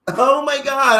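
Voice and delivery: loud, high, stretched mock amazement. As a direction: gush loudly and draw it out.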